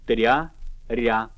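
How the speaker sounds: ordinary talk, nothing marked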